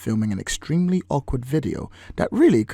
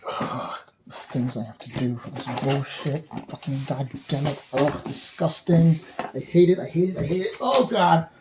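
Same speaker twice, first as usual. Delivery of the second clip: annoyed ugh; 4 semitones higher than usual. Acted. Grumbling about a chore.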